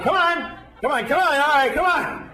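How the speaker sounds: high, pushy mock instructor chant; yoga teacher urging. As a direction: egg the class on like a spin coach. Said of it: "he's going".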